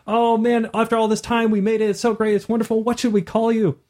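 high, gushing happy voice; overjoyed mother. Acted. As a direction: pour out joy at finding her child. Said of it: "she's like".

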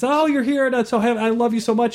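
high, gushing happy voice; overjoyed mother. Acted. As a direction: pour out joy at finding her child. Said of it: "she's very happy".

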